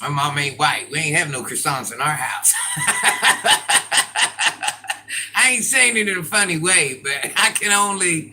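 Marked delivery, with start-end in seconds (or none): 2.6-5.3 loud, long burst of laughter; high cackling laugh. Real, not acted. Streamer cracking up at her own joke, cackling.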